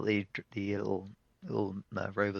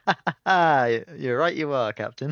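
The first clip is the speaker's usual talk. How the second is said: loud, high forced laugh into agreement; humouring the captain; 5 semitones higher, 10 dB louder than usual. Acted. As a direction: play along with the silly captain. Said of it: "talks to you like they're humouring you".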